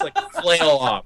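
normal talking voice

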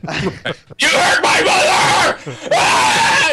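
high, slow crying shout; anguished shout. Retold or imitated. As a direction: scream it in grief. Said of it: "the Kingpin scene".

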